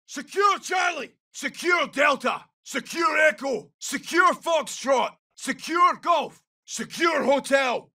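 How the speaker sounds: high, slow, drawn-out callouts; shouted combat orders. Acted. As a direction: shout each objective across the battlefield. Combat orders called to squad.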